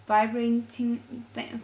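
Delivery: ordinary delivery, nothing marked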